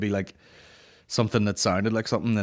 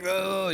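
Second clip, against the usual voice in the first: high, drawn-out mooing moan; 10 semitones higher than usual. Retold or imitated. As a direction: moo like someone in labour. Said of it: "that sort of mooing".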